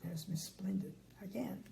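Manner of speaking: soft unvoiced aside; whisper. Real, not acted. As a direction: whisper to the listener.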